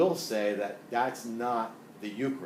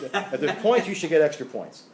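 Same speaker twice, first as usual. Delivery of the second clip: laughing; 5 semitones higher, 8 dB louder than usual. Real, not acted. Joking about extra points.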